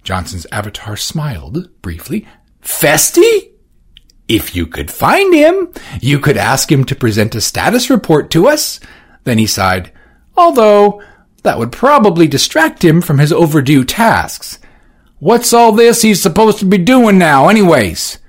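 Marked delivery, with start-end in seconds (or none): 2.6-14.6 very high, loud arch reply; dry sarcasm. Acted. Johnson sarcastically answering Orsino. 15.2-18.2 high, loud impatient question. Acted. Edward impatiently questioning Johnson. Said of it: "asked Edward with impatience".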